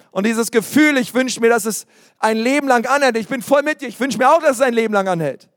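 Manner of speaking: normal talking voice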